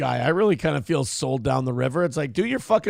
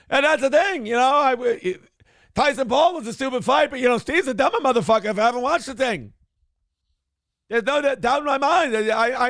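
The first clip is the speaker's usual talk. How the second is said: high, insistent ranting host impression; Kevin Brennan; 7 semitones higher than usual. Mock host ranting about Friday's fight, indignant impression. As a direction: rail on indignantly in a pitched-up voice. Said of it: "Get your Brennan drop ready".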